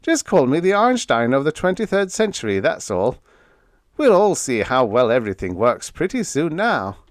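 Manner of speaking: joking self-praise, lightly voiced; shrugging it off. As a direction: toss off the brag with a shrug. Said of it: "Vern shrugged his shoulders".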